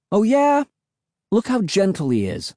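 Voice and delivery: high, challenging retort; insist, starting high on the challenge; insistent. A narrator's reading. Defending the dog to Emily, high and insistent. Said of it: "I insisted".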